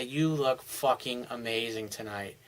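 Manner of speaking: slow intimate murmur; slow seductive compliment. Shown as a demonstration. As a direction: say it low and unhurried, right to her. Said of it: "you just say".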